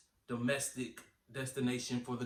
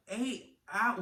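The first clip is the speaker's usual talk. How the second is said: retold disbelief; 7 semitones higher than usual. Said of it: "I was just like".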